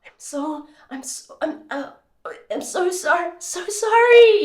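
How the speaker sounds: put-on a boy caught out, stumbling to apologise; embarrassed lifeguard stammering sorry to the mother; flustered apology. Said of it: "And he was like".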